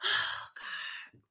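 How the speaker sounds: sighed, barely voiced; exasperated sigh. Real, not acted. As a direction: let it out as a weary sigh. Exasperated sigh mid-rant.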